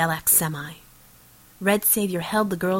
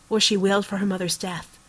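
plain reading voice

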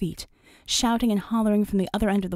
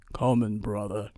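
very low giant's voice; huge Samoan brother; 10 semitones lower, 3 dB quieter than usual. Acted. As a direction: answer as an enormous, easygoing islander, pitched right down.

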